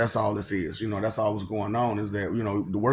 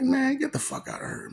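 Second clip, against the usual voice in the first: high, squeaky brush-off; mock dismissive squeal; 14 semitones higher, 3 dB quieter than usual. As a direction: scoff it away way up high. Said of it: "And I'm like".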